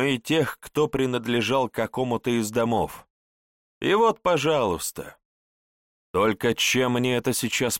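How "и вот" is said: usual speaking voice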